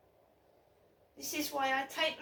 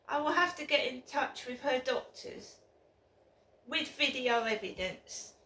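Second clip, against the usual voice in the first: ordinary talk, nothing marked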